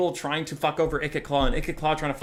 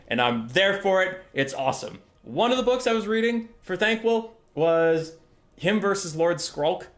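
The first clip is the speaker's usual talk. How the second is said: normal talking voice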